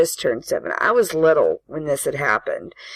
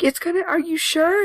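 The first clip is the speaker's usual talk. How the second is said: much higher, timid pleading question; scared child; 13 semitones higher than usual. Retold or imitated. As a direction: ask for reassurance like a frightened kid. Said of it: "I'm like".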